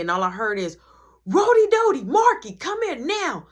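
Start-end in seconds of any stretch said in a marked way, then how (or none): 1.3-3.5 high, loud calling yell; mom calling out. Retold or imitated. Mom calls both sons to come at once, yelling. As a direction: call them from across the house.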